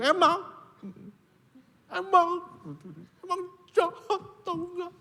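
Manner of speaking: mock frank accusation; blunt retort. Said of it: "Ngomongnya terus terang".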